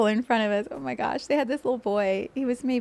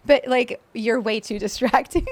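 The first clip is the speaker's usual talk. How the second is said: laughing flustered; 4 semitones higher, 8 dB louder than usual. Retold or imitated. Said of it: "she goes, retold".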